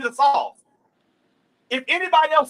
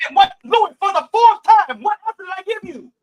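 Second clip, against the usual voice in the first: louder and higher, fed-up demand; exasperated; 8 semitones higher, 9 dB louder than usual. Real, not acted. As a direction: press him hard, out of patience. Heated debate, repeating answer.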